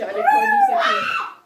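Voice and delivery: grito agudo de espanto; espantada. Real, not acted. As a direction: exclama chocada com a cor. Reação chocada à comida. (gritando espantada)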